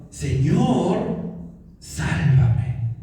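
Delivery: lightly voiced, low earnest plea to the Lord; a touch of a directed plea. Pedro sinking, calling straight to the Lord, mild low plea. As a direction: call on the Lord by name, low and sure. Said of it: "gritó con una dirección".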